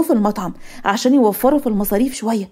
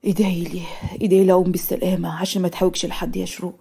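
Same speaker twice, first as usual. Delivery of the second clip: low, soft, subdued request; downcast plea; 5 semitones lower, 5 dB quieter than usual. Acted. Injured brother asking his sister to pray for him, downcast. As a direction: ask for prayers with a lowered head, quietly. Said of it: "ورجع نزل راسه للأرض".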